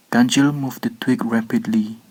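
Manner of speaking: ordinary delivery, nothing marked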